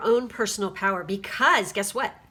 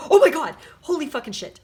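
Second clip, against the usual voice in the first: stunned outburst; 6 semitones higher, 10 dB louder than usual. Real, not acted. Tarot reader stunned by matching cards.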